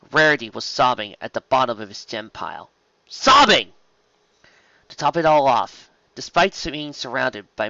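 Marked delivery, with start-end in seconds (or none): 3.1-3.7 high, loud outburst on one word; emphatic exclamation. A narrator's reading. Narrator dwelling on Rarity's sobbing, emphatic exclamation.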